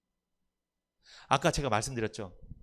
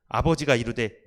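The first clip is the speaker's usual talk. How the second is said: ordinary talk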